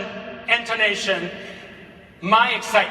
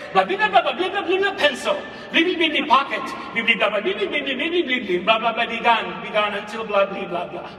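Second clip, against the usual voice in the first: students' view of it. Retold or imitated. Teacher imitating what kids hear, as sing-song blah. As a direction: show how the text sounds to kids. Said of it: "it sounded like this".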